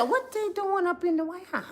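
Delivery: high, soft disbelieving asking; hushed high mock bewilderment; incredulous quote. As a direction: wonder aloud, baffled and hushed. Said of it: "I said".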